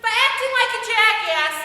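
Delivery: loud, high rebuke; heated, loud reproach; angry scolding. Acted. Scolds her in acted quarrel.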